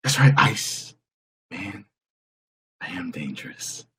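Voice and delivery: a hint of Tom Cruise, dropping away; Tom Cruise impression. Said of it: "Here's my impression of Tom Cruise".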